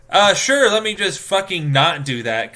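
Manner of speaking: loud, exasperated mock agreement; sarcastic refusal.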